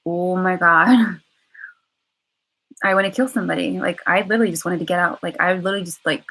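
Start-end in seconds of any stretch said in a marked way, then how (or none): 0.1-1.2 laughing exclamation. Retold or imitated. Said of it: "reaction would be like".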